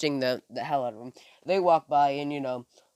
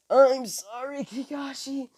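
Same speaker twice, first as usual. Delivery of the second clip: apologetic; 9 semitones higher than usual. Retold or imitated. Naruto apologizing after scolding.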